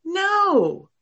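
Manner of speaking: loud, high, exclamatory NO; a forceful high no to doubting students; emphatic no. Real, not acted. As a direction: shout it down, insistent. Teacher rejecting students' doubt.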